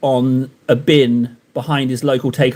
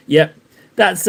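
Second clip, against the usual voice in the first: usual speaking voice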